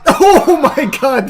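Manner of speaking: high, slow, loud disbelief; astonished high laughing cry; laughing amazement. Real, not acted. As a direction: draw it out through a laugh. Surprised the move worked.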